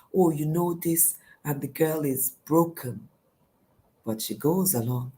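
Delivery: normal talking voice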